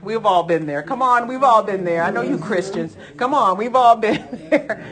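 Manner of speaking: loud rising appeal to the congregation; preaching at full force. Real, not acted. Preacher calling on his congregation, loud urging. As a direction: press them to admit it.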